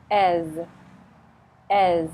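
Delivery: As said alone to show the end of says; isolated ending. Shown as a demonstration. Teacher isolating the ending of says, loud. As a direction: drill the -es of says. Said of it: "has the Z on the end".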